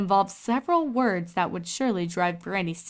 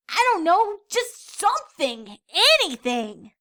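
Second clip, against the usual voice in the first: much higher, louder eager filly; young filly Scootaloo; 10 semitones higher, 5 dB louder than usual. A narrator's reading. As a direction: spell it out proudly, high. Scootaloo still bored.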